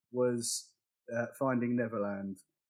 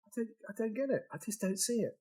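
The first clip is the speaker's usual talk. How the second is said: high, softer baffled protest; 8 semitones higher, 5 dB quieter than usual. Retold or imitated. Reacting to a friend's one-star review, baffled. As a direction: say it quietly, pitched up in bewilderment.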